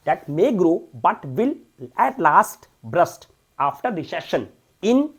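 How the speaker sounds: slow, loud reading of the English passage; slow dictation read. Shown as a demonstration. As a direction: read each word out slowly for the class. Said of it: "reads the passage aloud slowly".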